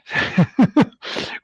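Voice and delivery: short airy laugh; breathy laugh. Real, not acted. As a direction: laugh at stumbling over the name. Laughs at tongue-twister name.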